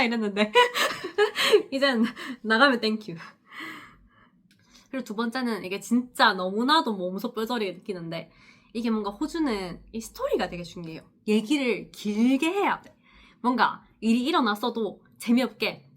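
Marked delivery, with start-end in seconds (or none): none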